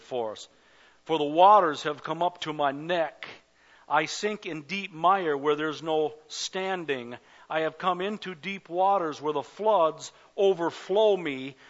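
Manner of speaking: loud, high, building preacher's cry; full-force preaching. Acted. Pastor preaching psalm to congregation, loud and high. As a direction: preach it with rising fire to the congregation.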